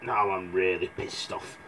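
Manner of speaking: low grumbling giant; lightly voiced annoyed giant; a hint of a cross giant. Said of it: "The giant then got up and went".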